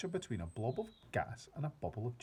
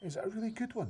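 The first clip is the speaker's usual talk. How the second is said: put-on high, eager child's voice; a curious young girl asking; girl asking the giant, high and eager; little girl; 11 semitones higher, faster than usual. A narrator's reading. Sophie's child voice.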